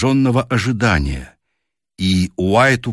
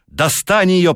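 breathless; 10 semitones higher, slower than usual. A narrator's reading. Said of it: "задыхаясь".

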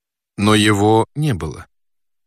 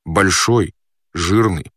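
ordinary delivery, nothing marked

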